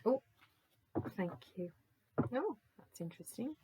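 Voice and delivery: ordinary talk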